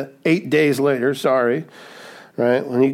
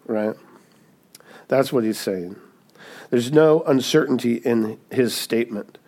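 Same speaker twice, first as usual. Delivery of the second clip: normal talking voice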